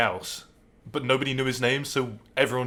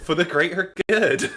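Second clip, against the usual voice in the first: higher mock West Country village chant; hammed-up West Country chant, high-pitched; Hot Fuzz villager; 6 semitones higher, 4 dB louder than usual. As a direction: echo it in a raised West Country burr. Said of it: "Hot Fuzz".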